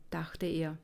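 usual speaking voice

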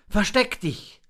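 high-pitched raven's order; urgent command. A narrator's reading. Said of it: "befahl der Rabe".

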